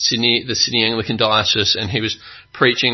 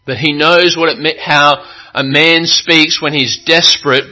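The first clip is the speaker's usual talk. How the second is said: raised, louder pulpit proclamation; impassioned preaching; 5 semitones higher, 8 dB louder than usual. Acted. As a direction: proclaim it with mounting conviction and insistent emphasis. Preaches with full force.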